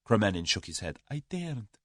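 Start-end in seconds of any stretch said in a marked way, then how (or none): 1.1-1.8 high, soft fearful Russian; frightened refusal. A narrator's reading. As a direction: shrink from answering. Cornered coward refuses to tell.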